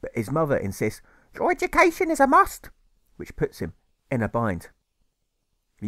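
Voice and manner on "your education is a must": mother's voice. Acted. Mother voiced in rhymed story.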